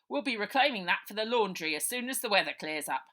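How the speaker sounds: loud, fast policeman's voice; old sergeant herding the children; bluff old copper. Acted. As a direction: settle them down officiously. Sergeant declares.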